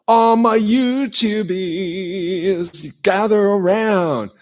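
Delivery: high, loud drawn-out call; slow sing-song crowd call. Acted. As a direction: summon the fans from afar. Calls fans to gather.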